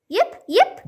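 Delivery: high little puppy yips. Acted. The lost puppy answering from the woods, yipping. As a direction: yip like a small puppy.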